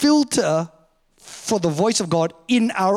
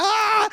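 high-pitched fake "ah!" of laughter; mock party laugh; 13 semitones higher than usual. Man at a party laughing along at a joke, mock laugh. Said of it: "I'm laughing 'cause everybody's laughing".

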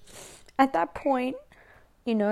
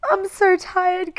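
louder tearful cry, high and slow; real sobbing; 8 semitones higher, 5 dB louder, slower than usual. Real, not acted. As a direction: let the sob burst out on the repeat. Crying about her mother.

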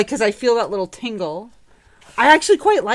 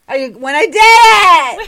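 proud excited shout; 7 semitones higher, 9 dB louder, much slower than usual. Real, not acted. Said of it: "I was like a child".

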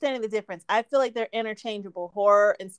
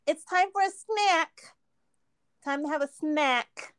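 higher, slow, sing-song snack; drawn-out snack; 4 semitones higher, slower than usual. Retold or imitated. Mocking people who over-stress snack, in a drawn-out voice. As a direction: stretch out snack sweetly to a child. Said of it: "if they emphasize the act".

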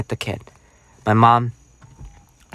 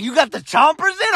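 proud mom. Retold or imitated. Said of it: "She's like".

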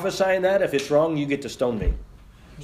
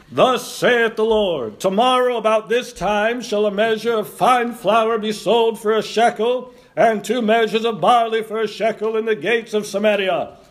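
slow, raised, proclaiming prophet voice; booming prophet; 7 semitones higher, 4 dB louder than usual. Pretend. Preacher acting Elisha for the congregation, slow proclaiming voice. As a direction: declaim it slowly like an Old Testament prophet. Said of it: "let's try to be Elisha for a minute".

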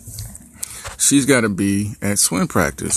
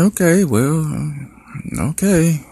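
stunned okay; 7 semitones higher, much slower than usual. Retold or imitated. Dad taking in his daughter's refusal, high and baffled. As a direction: let the pitch jump in disbelief.

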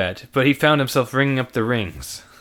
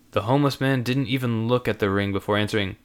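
ordinary delivery, nothing marked

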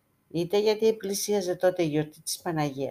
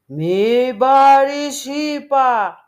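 very slow, huge and much louder; terrible booming voice; 7 semitones higher, 12 dB louder, much slower than usual. A narrator's reading. The icon warns the thief a last time, thunderous. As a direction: thunder the warning down. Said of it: "και πάλι τρομερή φωνή ακούστηκε".

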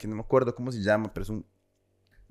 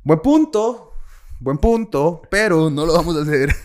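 loud, high mock concession; raised voice granting the point, refusing firmly; mock agreement; 4 semitones higher, 7 dB louder, slower than usual. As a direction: play emphatic, exaggerated diplomacy. Retold mock concession to the attacker.

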